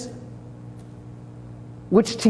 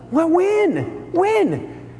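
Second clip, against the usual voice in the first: puzzled when; 9 semitones higher, much slower than usual. Retold or imitated. Sheep puzzled, asking Jesus when.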